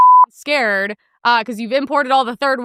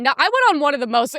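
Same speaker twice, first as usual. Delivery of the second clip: ordinary talk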